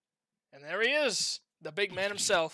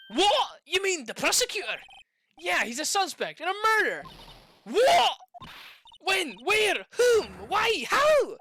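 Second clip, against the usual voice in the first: loud, much higher shocked questions; 11 semitones higher, 7 dB louder than usual. Acted. Lawyer stunned by the prosecutor's arrest, shouting. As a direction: fire off the questions in disbelief.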